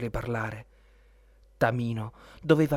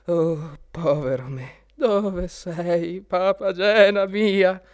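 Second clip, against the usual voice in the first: louder, slow lament rising to "Papagena mia"; wanting to shout; 6 semitones higher, 5 dB louder, much slower than usual. Acted. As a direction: cry out for his lost love, drawing it out. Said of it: "avrebbe voluto gridare".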